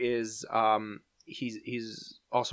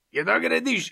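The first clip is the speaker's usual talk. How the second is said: high imitated scolding yell; mock German shout in high singsong intonation; German dad's yell; 9 semitones higher, 4 dB louder than usual. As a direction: mimic a German father yelling, pitched way up. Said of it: "just that intonation of saying something".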